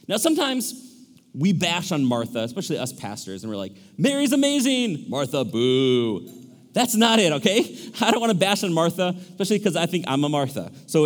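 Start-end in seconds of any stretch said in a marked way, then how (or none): 4.0-5.0 high-pitched, gushing praise; hammed-up adoring exclamation; mock cheering. Pastors cheer Mary, boo Martha. 5.1-6.3 low, drawn-out boo; hammed-up thumbs-down dismissal; mock booing. Pastors cheer Mary, boo Martha.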